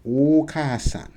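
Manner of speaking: "Okasan." slow and clear, the middle a barely said; Okasan model word. Shown as a demonstration. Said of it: "So you're saying".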